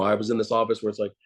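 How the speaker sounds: normal talking voice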